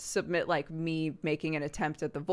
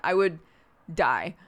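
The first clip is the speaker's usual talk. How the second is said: ordinary talk, nothing marked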